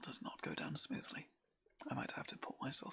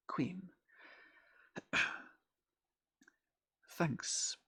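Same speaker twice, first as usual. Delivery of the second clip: normal talking voice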